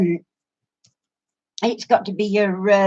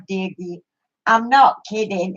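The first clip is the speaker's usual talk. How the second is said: ordinary delivery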